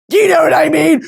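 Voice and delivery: loud, high, pleading do you know what I mean; exasperated shout. Acted. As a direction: belt it out in mock exasperation. Repeats the question louder.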